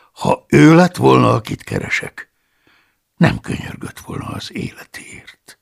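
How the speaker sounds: plain reading voice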